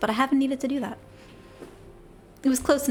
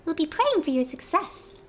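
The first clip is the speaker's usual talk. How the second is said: Tataru; 5 semitones higher than usual. Acted. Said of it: "Goblin voice?".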